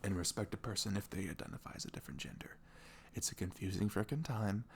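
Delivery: hushed, close whisper to a partner; ASMR whisper. Acted. Boyfriend whispering his thoughts to his girlfriend in bed. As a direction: murmur it close to her ear.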